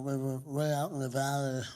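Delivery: usual speaking voice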